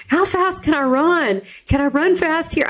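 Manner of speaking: put-on high, eager little-kid voice; silly kid questions. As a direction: imitate an excited child asking, pitched well up. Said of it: "really silly kid-like stuff".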